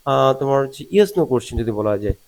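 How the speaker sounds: usual speaking voice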